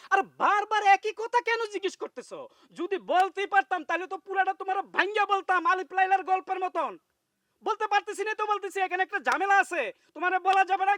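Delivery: husband shouting back at a nagging wife. Acted. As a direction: snap back at her, fed up and loud. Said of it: "তুমি আমার সাথে চিল্লায় কথা বলতেছো".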